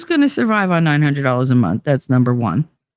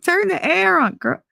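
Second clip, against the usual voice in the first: mock plea, pitched way up; retold exasperation; 14 semitones higher than usual. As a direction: imitate an exasperated son begging for relief. Retold son exasperated by heat.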